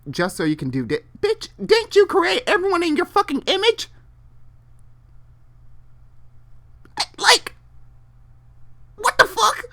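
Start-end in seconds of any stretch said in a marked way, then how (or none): 1.2-9.6 very high, loud disbelieving rant; outraged squeal. Real, not acted. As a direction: screech it in outrage at God.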